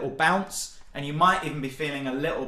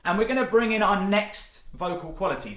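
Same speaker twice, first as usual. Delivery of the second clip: ordinary talk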